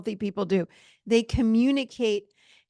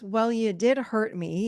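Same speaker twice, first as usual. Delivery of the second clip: normal talking voice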